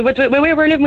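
ordinary talk, nothing marked

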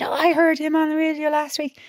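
high-pitched imitation of a child; excited child. A mother quoting her child about a radio guest, high child's voice.

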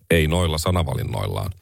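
deadpan it with no lift on any word; monotone Orpo impression. Mocking the prime minister's reply, in a low monotone. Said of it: "Hänhän puhuu aika sellaisella monotonisella äänellä".

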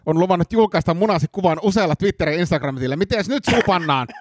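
high-pitched, insistent blackmailer; epäilty, uhkaava. Acted. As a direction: corner the inspector with a threat. Suspect blackmails the inspector. (uhkaava, kiihtynyt epäilty)

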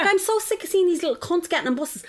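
high-pitched, exasperated retold tirade; indignant rant. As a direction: rant it out, stretching the key words. Retold rant at bus harassers.

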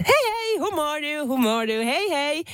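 high, bright-eyed sing-song hello to tourists; mock cheerful greeting. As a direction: greet them like an overeager local. (teeskennellyn iloinen tervehdys)

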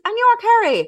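high, quick mimic of the caller; mock puzzled caller; quoted caller. Imitating caller, high pitched.

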